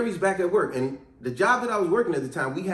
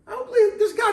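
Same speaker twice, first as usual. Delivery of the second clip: disbelief; 4 dB louder than usual. Real, not acted. Narrator's disbelief at Jerry.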